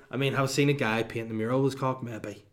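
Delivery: ordinary talk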